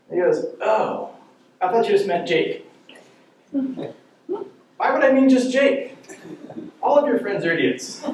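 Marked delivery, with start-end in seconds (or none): 0.6-2.7 put-on high, naive teen voice; mock innocent teenager; innocent teen excuse. Son's excuse retold. 3.5-8.0 high, slow disbelief; hammed-up incredulous father; incredulous dad. Father's retort retold.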